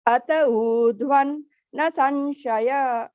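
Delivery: very slow "Ata urdhvan na sanshaya", split after "urdhvan"; pause between the words. Shown as a demonstration. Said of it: "take a quick pause after".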